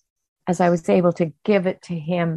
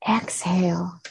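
whisper; 4 dB quieter than usual. Acted. Guided breathing, exhale cue.

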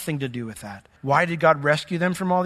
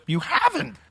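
imitated high-pitched disbelief; incredulous little question; astonished reply; 9 semitones higher than usual. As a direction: echo her surprise, pitched way up. Classmate's retold astonished reply.

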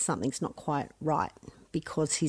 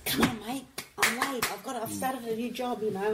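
retold urging, pitched higher, lightly acted; hurrying son along; 5 semitones higher than usual. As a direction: mum hurrying a sleepy son out the door. Mum urging late son.

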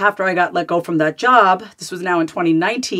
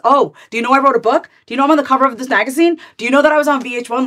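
mock show-off, pitched up and louder; lightly hammed-up boasting in a higher, quicker voice; eager bragging; 5 semitones higher, 4 dB louder, faster than usual. Woman mimicking herself impressing a date, eager bragging.

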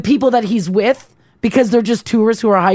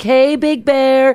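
slow drawn-out call to bear; 5 semitones higher, slower than usual. Shown as a demonstration. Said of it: "saying things like".

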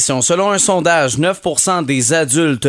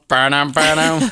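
a poor, barely changed impressionist bit; bad impression; slower than usual. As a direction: toss off a weak impression. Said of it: "la pire imitation d'André-Philippe Gagnon".

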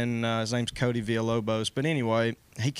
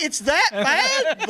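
high, slow, laughing "It's that bad?"; hearing about the bald spot, a high, drawn-out laughing shock; shocked disbelief; 6 dB louder, much slower than usual. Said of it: "And I'm like".

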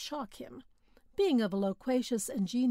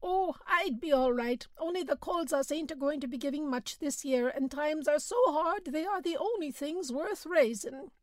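peasant voice; 5 semitones higher than usual. A narrator's reading.

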